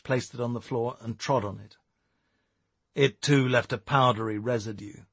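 plain reading voice

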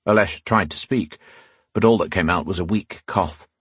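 ordinary delivery, nothing marked

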